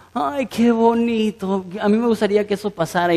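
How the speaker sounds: a hint of mock tears-of-joy sighing; mock sentimental gush. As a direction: coo it like a touched TV fan, teasing. Mocking sentimental TV viewer.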